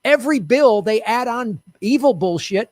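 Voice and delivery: ordinary delivery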